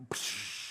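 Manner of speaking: breathy pop noise; lid pop sound. Retold or imitated. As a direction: mimic the lid popping. Popping the Tupperware lid.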